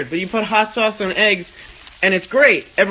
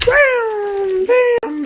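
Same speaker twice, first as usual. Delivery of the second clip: long, held, very high "bam" noises; mock impact sound, squealed and sustained; high-pitched "bam". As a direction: sing out each bam way up high. Calls out a cooking move.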